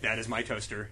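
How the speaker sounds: low, soft gruff voice; deadpan low growl about a toaster; a low, quiet, gruff hero voice; mock gruff voice. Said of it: "I'm like".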